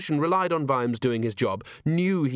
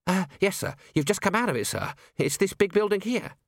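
put-on baffled young constable; 8 semitones higher than usual. A narrator's reading. Constable confused answering Vimes.

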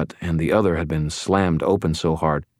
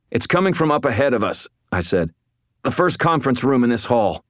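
urgent strained; 8 semitones higher, 3 dB louder than usual. Acted. Urgent discovery, straining ahead.

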